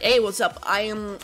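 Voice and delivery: ordinary talk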